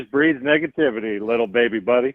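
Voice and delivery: lightly voiced Elvis giving gentle advice; Elvis impression. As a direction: soothe him like a caring Elvis. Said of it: "this was [name]".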